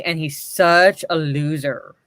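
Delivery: slow, with "such" leaned on hard; stress on such. Retold or imitated. Streamer reading the comic's all-caps word, stressing "such". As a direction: drag out "such a loser". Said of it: "is all caps to emphasize".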